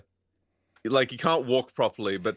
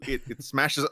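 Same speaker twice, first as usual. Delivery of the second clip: ordinary talk, nothing marked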